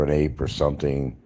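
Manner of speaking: ordinary delivery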